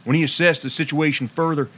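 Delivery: usual speaking voice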